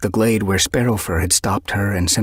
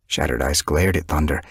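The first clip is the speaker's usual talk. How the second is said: ordinary delivery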